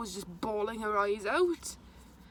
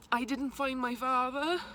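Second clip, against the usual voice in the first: ordinary talk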